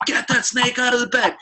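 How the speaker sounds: high, frantic shout from a nightmare; retold panicked shout at the girlfriend; panicked yell. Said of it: "I was just like".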